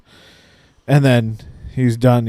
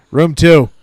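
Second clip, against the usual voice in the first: a louder call, a little higher; retold yell; 6 semitones higher, 8 dB louder than usual. Patient yelling the room number to the doctor, louder call. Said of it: "I just yelled".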